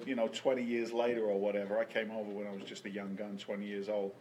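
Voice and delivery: ordinary delivery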